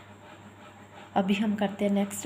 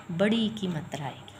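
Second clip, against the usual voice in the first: usual speaking voice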